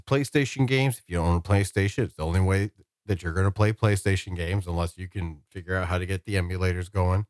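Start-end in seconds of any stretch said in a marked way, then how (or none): none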